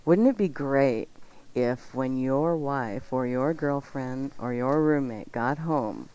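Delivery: ordinary talk, nothing marked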